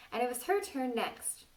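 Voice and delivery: plain reading voice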